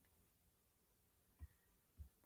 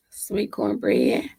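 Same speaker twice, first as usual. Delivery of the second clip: low slow murmur; 12 semitones lower, 6 dB quieter, slower than usual. Real, not acted. Musing about dinner.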